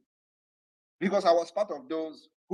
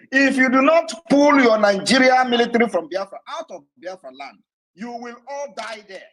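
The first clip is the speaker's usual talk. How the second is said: higher, much louder furious threat; repeated warning shouted; 7 semitones higher, 11 dB louder than usual. Real, not acted. To the Nigerian military, repeating his threat: furious shout. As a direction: bellow the ultimatum at the soldiers.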